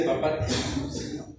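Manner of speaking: soft, hushed words of love to God; tender whispered prayer. Shown as a demonstration. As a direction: breathe it out like an intimate prayer. Demonstrating a loving prayer.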